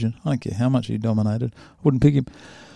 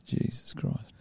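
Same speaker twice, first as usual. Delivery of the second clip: awed whisper; 14 dB quieter, slower than usual. Real, not acted. Amazed by fighter's record.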